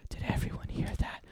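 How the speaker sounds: hushed, fully whispered question; very soft, secretive whisper. A narrator's reading. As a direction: whisper it to the group. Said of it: "Tobias whispered".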